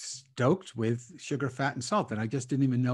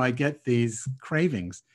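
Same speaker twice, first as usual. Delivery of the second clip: normal talking voice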